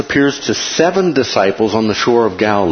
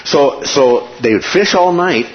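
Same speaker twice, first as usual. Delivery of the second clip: ordinary delivery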